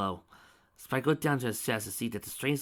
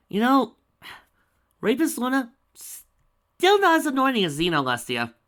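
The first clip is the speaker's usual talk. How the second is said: higher, louder sarcastic jab; mocking aside; 11 dB louder than usual. Real, not acted. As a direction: break off to mock the character.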